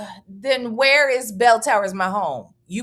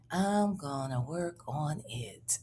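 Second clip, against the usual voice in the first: whisper; 8 semitones lower, 12 dB quieter, slower than usual. Real, not acted. Repeats, then whispers it.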